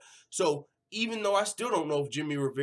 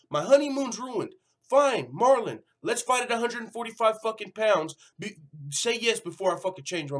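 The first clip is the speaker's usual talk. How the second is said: higher, louder fed-up rant building up; exasperated outburst; 6 semitones higher, 5 dB louder than usual. Retold or imitated. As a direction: act out a fighter who is fed up but accepts anyway. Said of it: "Jimmy Rivera's like".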